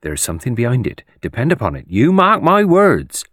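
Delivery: knowing gossip, slowing and rising loudly at the end; insistent village gossip. A narrator's reading. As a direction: village busybody laying down the law. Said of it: "who repeated ad nauseam that".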